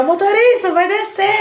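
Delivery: high-pitched bus passenger's yell; calling out. Retold or imitated. Passenger yelling to the bus driver, calling out. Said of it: "é você gritar".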